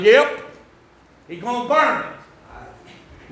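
slow Tennessee hillbilly drawl, "burn" drawn out. Retold or imitated. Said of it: "saying it like that".

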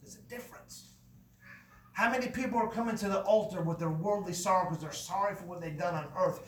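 ordinary talk, nothing marked

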